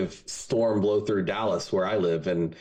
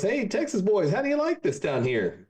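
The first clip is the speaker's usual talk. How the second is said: higher, sly greeting from the Devil; 11 semitones higher than usual. Acted. The Devil welcoming two Texans to hell, higher mock greeting. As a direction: tease the damned like a smug host.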